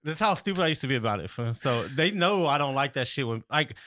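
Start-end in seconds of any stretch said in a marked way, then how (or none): none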